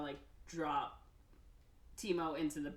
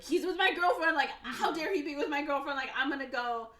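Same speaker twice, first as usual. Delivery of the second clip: jealous outrage; 9 semitones higher, 8 dB louder than usual. Retold or imitated. Seventeen jealous about girlfriend.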